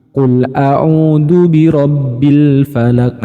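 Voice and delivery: slow recitation demo of a'udzu mispronounced; wrong dzal as d. Shown as a demonstration. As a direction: chant a'udzu with a hard d. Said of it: "Itu kurang tepat".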